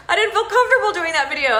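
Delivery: fast, laughing confession. Real, not acted. Admitting to the group she felt awkward, laughing fast. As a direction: laugh it out quickly, a bit embarrassed.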